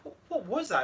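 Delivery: high, mock-startled question; retold puzzlement. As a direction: replay being caught by a strange sound. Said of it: "I was like".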